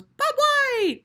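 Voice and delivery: very high whistled bird call; high ringing bobwhite call. Acted. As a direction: whistle it out like a quail.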